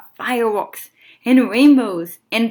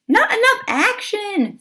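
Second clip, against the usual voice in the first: higher, slow mock-critical kid brother; brother's voice; 11 semitones higher, slower than usual. Brother Tim judging the story, high and slow. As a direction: sound like a bossy little brother.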